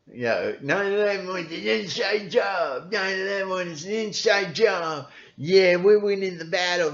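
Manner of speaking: high, silly put-on voice; mocking goofy fan. Mocking InfoWars fans, a high goofy cackling voice. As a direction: lampoon a gleeful conspiracy nut.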